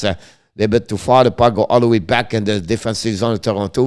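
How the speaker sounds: running hockey commentary, steady and brisk; live play-by-play. Acted. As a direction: call the game as it happens.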